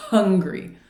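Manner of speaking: "hungry" with a strong puffed H; slow "hungry" led by heavy breath; exaggerated H. Shown as a demonstration. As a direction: huff the H to open "hungry". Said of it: "if they're hungry".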